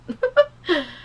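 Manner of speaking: a short crying laugh that jumps high; a wet, broken laugh that breaks upward; teary laugh. Real, not acted. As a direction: laugh through real tears. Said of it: "I guess not".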